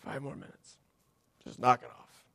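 drowsy, hushed, unbothered mumble; like someone waking up from a nap; sleepy dismissal. Retold or imitated. Jesus woken on the boat, sleepy and unbothered. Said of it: "he just kinda goes".

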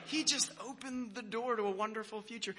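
high, starry-eyed voice; mock dreamy hope. Overzealous student imagining a friendship with his professor, mock dreamy.

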